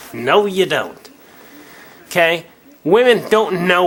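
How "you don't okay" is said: ordinary delivery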